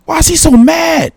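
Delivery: high, puzzled question at the screen; baffled, asking nobody in particular why he's so mad; amused disbelief. Real, not acted. Reacting to the clip, high disbelieving question.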